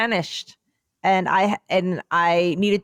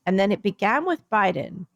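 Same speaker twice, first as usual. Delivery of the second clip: ordinary talk, nothing marked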